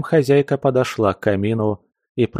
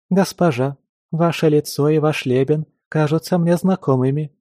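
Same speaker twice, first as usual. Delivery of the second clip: high, bright chirpy maid voice; chirping; 6 semitones higher than usual. Acted. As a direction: chirp it like a cheerful little bird. Stranger recognizes Anna.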